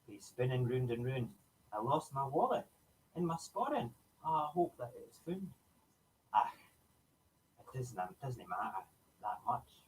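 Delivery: put-on lively Scottish accent poem reading. Host recites ceilidh poem in Scottish accent. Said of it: "we have to do it in a Scottish accent".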